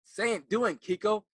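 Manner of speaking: loud, high, impatient teacher scolding; acting teacher scolding the breathy student, loud and impatient; bold acting teacher. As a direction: push the student to just talk plainly. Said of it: "She said".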